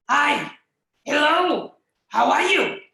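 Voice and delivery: loud, rough monster growl. Shown as a demonstration. Teacher demonstrating a monster voice for puppets. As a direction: rub the vocal cords and growl it. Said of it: "take out a monster voice".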